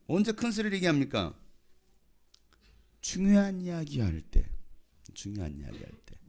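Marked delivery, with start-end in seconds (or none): 3.0-4.5 high, slow, emphatic statement; high-pitched and deliberate, falling at the end; emphatic answer. Real, not acted. States answer, then repeats it low. 5.1-6.1 very low, soft repeat; quiet low aside; hushed echo. Real, not acted. Same answer repeated low and soft.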